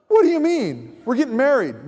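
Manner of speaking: indignant disbelief. Retold or imitated. Fiancée to Mark after being told to move out, indignant. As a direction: act out a taken-aback objection. Acted quarrel over moving out.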